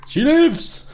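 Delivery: high, loud, triumphant shout; triumphant cry. Real, not acted. Streamer celebrating to chat that the Hydra survived, triumphant high shout. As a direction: crow it like a mad scientist whose creation just woke up.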